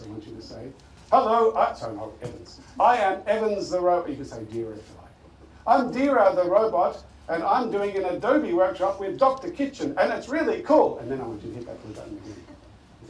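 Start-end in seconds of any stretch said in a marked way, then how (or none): 1.1-1.7 high, loud robot demo voice. Shown as a demonstration. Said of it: "in your best robot voice". 2.8-4.1 high, loud robot demo voice. Shown as a demonstration. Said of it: "in your best robot voice". 5.7-11.0 robot voice. Shown as a demonstration. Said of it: "in your best robot voice".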